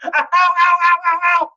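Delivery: high, loud imitated ow ow; mock cries of pain. Retelling a friend's knee injury, high yelping ows. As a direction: yelp it like a hurt player.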